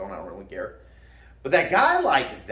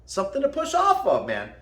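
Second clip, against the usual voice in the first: emphatic repeat; 7 semitones higher than usual. Shown as a demonstration. Coach repeating his point to viewers, emphatic. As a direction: drive the repeat home, pitched up.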